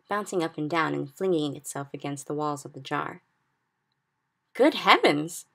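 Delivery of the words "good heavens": louder startled exclamation; crying out. A narrator's reading. Sophie cries out seeing the thrashing dream, startled. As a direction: gasp it out in shock. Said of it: "she cried".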